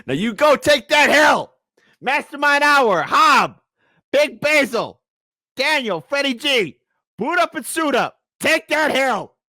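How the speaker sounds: high, loud hype rally cry; hype call-out. Real, not acted. Vlogger rallying his friends by name, loud excited hype call. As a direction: pump up the squad by name.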